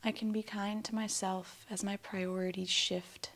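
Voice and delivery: calm, unhurried affirmation with long silences after; spoken affirmations. Acted. Guide giving affirmations for listeners to repeat, calm meditation voice. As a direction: say each statement gently, leaving room to repeat it.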